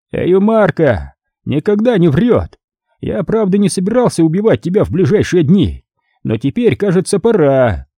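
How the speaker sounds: the crime boss sneering over his wrecked chair; cardinal's voice. A narrator's reading.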